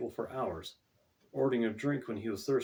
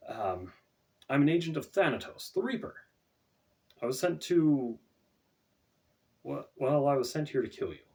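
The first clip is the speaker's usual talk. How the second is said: put-on halting, raised voice of a troubled young man; hesitant Dante; 4 semitones higher, 4 dB louder than usual. A narrator's reading. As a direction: stumble over the confession.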